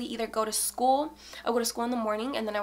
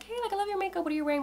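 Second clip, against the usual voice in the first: high-pitched imitation of a gushing girl; admiring girl; 9 semitones higher, much faster than usual. Pretty girl complimenting her makeup, imitated admiring girl. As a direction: put on a high, chatty fangirl voice. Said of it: "pretty girl and she comes up".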